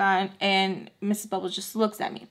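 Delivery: normal talking voice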